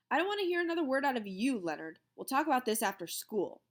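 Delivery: fast, loud stern rebuke; teacher scolding a pupil, loud and fast. A narrator's reading. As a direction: cut him off sternly.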